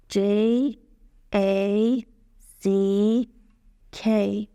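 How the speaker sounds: each letter drawn out, pauses between; spelling letter by letter. Shown as a demonstration. Teacher answering how to spell Jack, letter by letter. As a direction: spell it out slowly, one letter at a time. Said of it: "باید دونه دونه لترهامو بگم".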